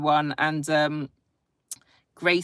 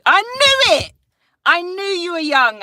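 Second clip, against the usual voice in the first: much higher and louder gotcha; triumphant exclaim; 13 semitones higher, 8 dB louder than usual. Real, not acted. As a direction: crow that she was right. Triumphant at guessing child's age.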